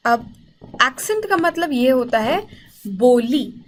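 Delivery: normal talking voice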